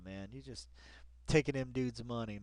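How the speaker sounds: usual speaking voice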